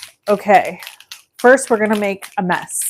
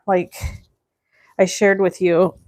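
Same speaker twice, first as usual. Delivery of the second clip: usual speaking voice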